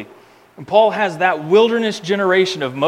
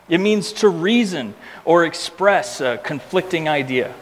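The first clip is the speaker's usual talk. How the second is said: usual speaking voice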